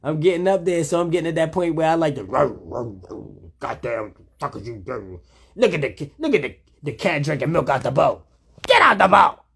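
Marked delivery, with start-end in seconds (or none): 3.6-9.4 mock-cranky old man griping at the cat, ending in a loud yell. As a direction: play a cranky old geezer fussing at the cat, then shout. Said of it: "I'm kind of an old man".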